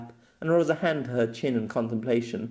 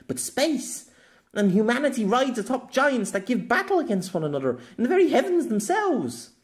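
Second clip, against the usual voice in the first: put-on higher, eager young woman, sharp and clever; Maka; 7 semitones higher than usual. A narrator's reading. Maka quizzing Pierre about being isekai'd, higher and eager. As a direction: voice Maka high and keen as she lays out her theory.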